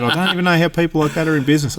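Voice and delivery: fast, high indignant rant; angry old bloke. Retold or imitated. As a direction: mimic the old man's outrage. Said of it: "He said".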